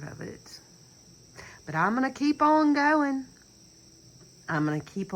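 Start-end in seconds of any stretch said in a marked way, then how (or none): 1.6-3.2 raised, forceful declaration of resolve; loud vow. Real, not acted. Speaking to viewers, she loudly vows to keep going. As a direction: belt out her promise to keep going.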